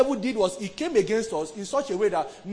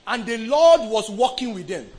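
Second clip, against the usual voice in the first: raised, ringing scripture reading; loud proclamation; 4 semitones higher, 9 dB louder than usual. Real, not acted. Preacher reading the verse out to the congregation, loud and high. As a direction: proclaim it loudly, pitched up.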